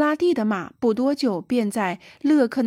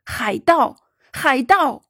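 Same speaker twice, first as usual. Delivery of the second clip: louder, indignant exclamation; angry storybook shout, moderately forceful; furious cry; 4 semitones higher, 4 dB louder, slower than usual. A narrator's reading. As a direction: cry out in outrage, louder on the repeat. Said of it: "勃然大怒地大叫道".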